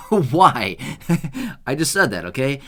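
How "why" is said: laughing. Real, not acted. Laughs at own slip.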